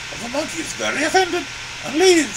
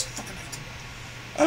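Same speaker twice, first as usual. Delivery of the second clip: whispered; 7 semitones lower, 9 dB quieter, much slower than usual. Acted. Muttered hatred of mice.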